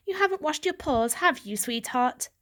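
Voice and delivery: mother gently scolding her kitten; doting mother. Acted. Mother fussing.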